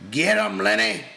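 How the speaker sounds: loud, high shout; cupped-hands yell. A narrator's reading. George yells through cupped hands at Lenny, loud. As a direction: call it out across a room. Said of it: "cupped his hands around his mouth and yelled".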